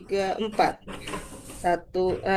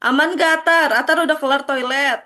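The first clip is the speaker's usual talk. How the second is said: calling out; 5 semitones higher, 5 dB louder than usual. Real, not acted. Calling a student back.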